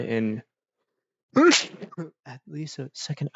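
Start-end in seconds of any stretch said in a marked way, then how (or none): 1.3-2.1 sharp cough. Real, not acted. Choking on drink while talking.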